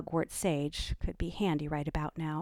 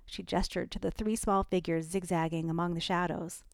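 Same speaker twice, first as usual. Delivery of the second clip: ordinary delivery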